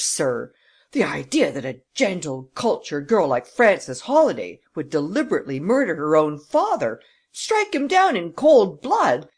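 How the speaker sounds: indignation building to a high, loud pitch; choked outrage. A narrator's reading. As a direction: splutter to a halt, overcome by outrage. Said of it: "fairly choked by his emotion".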